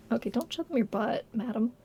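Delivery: soft, murmured aside to a pet; hushed to the cat. Real, not acted. Streamer softly scolds her cat on camera, hushed. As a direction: gently tell the cat off under your breath.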